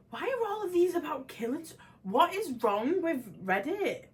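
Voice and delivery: fed-up high cry at the grim Reddit posts; exasperated outburst. Real, not acted. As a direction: throw up your hands at Reddit.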